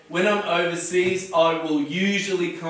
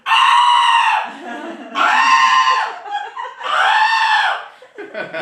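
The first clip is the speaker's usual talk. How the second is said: shrill, loud imitated bird squawk; bird screech; 6 dB louder, much slower than usual. Shown as a demonstration. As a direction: screech like a startled caged bird. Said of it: "You go".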